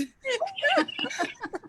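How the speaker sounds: high, bubbling laugh; happy laugh. Real, not acted. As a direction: laugh with tearful joy. Joyful end of her story.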